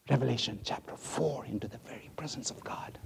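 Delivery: fast hushed whisper of awe. Acted. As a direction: breathe it out in reverent whisper.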